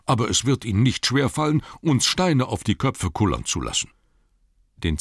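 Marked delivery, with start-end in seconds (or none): 0.0-3.9 high-pitched dwarf grumble; grumbling Bombur. Acted. Dwarf objecting that the Orcs can roll stones, grumbling. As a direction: complain in a raised, put-on dwarf voice. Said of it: "knurrte Bombur".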